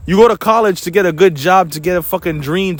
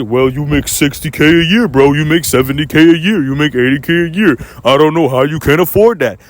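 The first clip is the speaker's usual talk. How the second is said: mocking the officials; 3 semitones lower than usual. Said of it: "and they say".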